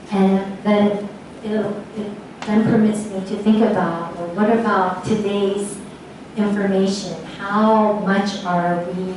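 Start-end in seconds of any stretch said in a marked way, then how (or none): none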